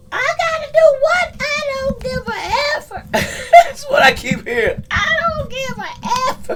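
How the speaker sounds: high put-on voice, half crying and laughing; high mock-wailing defiance; high defiant voice. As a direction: squeal that you don't care. Voicing the defiant reply.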